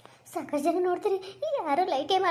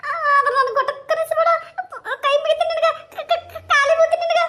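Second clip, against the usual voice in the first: very high, half-sobbing, stammering woman; tearful woman; 10 semitones higher, 6 dB louder than usual. Acted. A frightened woman promising the ghost she will stop crying, high mock sobbing.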